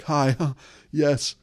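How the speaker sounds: hammed-up eager texter; mock overeager reply. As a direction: overdo the instant excited reply.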